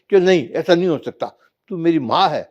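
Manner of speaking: angry son. Acted. Son argues with mother.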